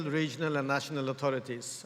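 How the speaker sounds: ordinary talk, nothing marked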